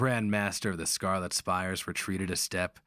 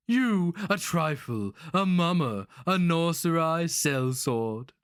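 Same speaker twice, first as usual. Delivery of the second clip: sneering; 10 semitones higher, 5 dB louder than usual. A narrator's reading. Sorcerer sneers insults at the sellsword, high scorn. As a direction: sneer each insult down at him. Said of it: "A sudden translucent sneer seized the face".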